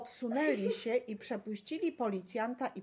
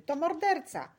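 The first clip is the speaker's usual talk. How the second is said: high, loud outcry; outraged mother crying out; shouted accusation; 8 semitones higher, 7 dB louder than usual. A narrator's reading. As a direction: denounce him at the top of your voice. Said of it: "krzyknęła mama Doroty".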